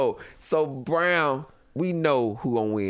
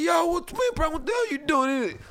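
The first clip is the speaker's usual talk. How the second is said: startled protest; faster than usual. Retold or imitated. Inmates protest TV cut off.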